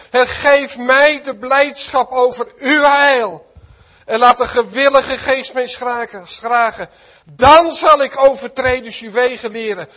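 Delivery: loud, high, fervent psalm prayer at the top of the voice; preaching at full force. Acted. Preacher reading a psalm to his congregation, preaching at full force.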